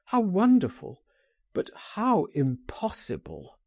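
high, rising wonder falling into regret; marvel, then give up hope; wistful cry. A narrator's reading. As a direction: cry out longingly, then doubt it. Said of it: "he cried".

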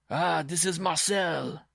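slow, high-pitched musing; pleased self-talk. A narrator's reading. Simon guessing his visitor, pleased slow self-talk. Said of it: "he told himself".

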